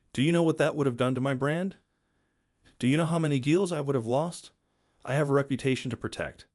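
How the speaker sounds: usual speaking voice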